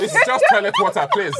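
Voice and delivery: high, giggling plea; laughing. Real, not acted. Husband defending toilet water habit, laughing hard. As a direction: squeal it through laughter.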